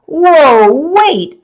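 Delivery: sudden high, loud gasp of alarm; choking in alarm. A narrator's reading. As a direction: blurt it out, panicking. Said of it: "I started to choke".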